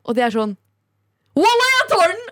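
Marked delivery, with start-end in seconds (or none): 1.4-2.2 high, mock-eager exclamation; put-on excited outburst, pitched way up; eager retold reply. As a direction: imitate an over-keen guy jumping at the offer.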